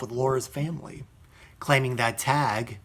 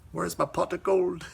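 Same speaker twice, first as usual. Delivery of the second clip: a jokey leprechaun brogue; Irish leprechaun voice; mock leprechaun Irish; 3 semitones higher, 3 dB quieter than usual. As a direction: play the cartoon leprechaun in an Irish accent. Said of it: "my Irish accent".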